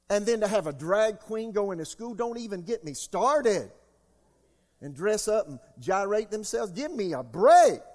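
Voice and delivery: loud, high preaching at full force. Acted. Preacher to congregation, loud rising outrage. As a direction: rail against it from the pulpit, voice climbing.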